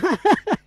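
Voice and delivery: high, staccato chuckle; derisive laugh. Real, not acted. Preacher ridiculing those who weep for exalted Christ, derisive laugh. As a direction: burst into brief mocking laughter.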